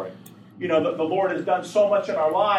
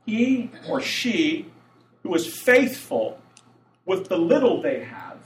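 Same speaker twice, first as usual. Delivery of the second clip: slow, spaced-out phrases, "faithful" leaned on; emphatic repeat; 5 semitones higher, slower than usual. Real, not acted. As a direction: let each phrase land slowly. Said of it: "Let me say that again".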